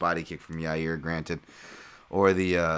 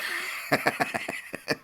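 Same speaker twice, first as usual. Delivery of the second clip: high, unvoiced-sounding wheezy laugh; 9 semitones higher than usual. Real, not acted. Commentator laughing at his Oompa Loompa jab, real laugh. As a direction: crack up at your own joke.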